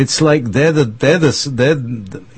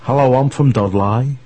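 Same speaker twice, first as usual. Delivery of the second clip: mock a Dudley local's accent; 2 semitones higher than usual. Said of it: "everybody who comes from Dudley speaks like this".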